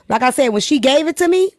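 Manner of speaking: ordinary delivery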